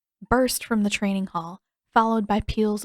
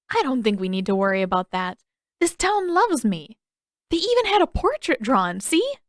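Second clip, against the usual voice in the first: proud; 4 semitones higher, 4 dB louder than usual. Acted. Aang bragging proudly.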